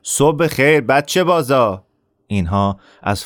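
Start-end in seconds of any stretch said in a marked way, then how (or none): 0.0-1.8 slow, higher jeering call; bully shouting a taunt; mock shouted greeting. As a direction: call out a sneering insult. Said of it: "دست تکان داد و داد زد".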